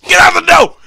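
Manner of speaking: high, loud repeated yell; shouted command. Retold or imitated. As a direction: bellow it at the doors. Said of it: "And this guy just".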